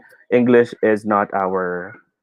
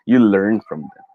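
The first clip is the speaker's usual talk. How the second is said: ordinary talk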